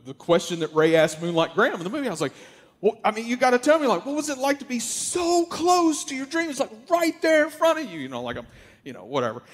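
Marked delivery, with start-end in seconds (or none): 2.8-8.0 much higher, rushing with excitement; can't wait for the answer; eager. Retold or imitated. Asking the pitcher about being close to his dreams, much higher and eager. Asks the Moonlight Graham question.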